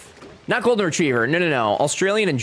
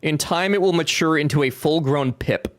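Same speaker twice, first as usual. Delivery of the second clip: ordinary delivery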